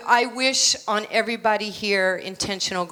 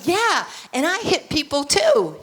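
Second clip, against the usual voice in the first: put-on high, eager childlike brag; loud, high punch on the last word; cocky kid; 6 semitones higher, 4 dB louder than usual. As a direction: boast it proudly, pitch shooting up. Child self boasting to Ali.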